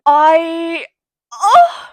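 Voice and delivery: high, loud "oh" shooting up; playful squeal. Acted. Reader losing it over the book's flirting, playful squeal. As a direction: burst out in a rising squeal. Strange outburst after reading the line.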